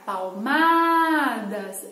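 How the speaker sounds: "palmadas" with a long, held "ma"; elongated stressed syllable. Shown as a demonstration. Teacher shows pupils the stressed syllable, stretching the "ma" in "palmadas". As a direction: draw out the middle syllable of "palmadas". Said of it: "a sílaba mais alongada é a má".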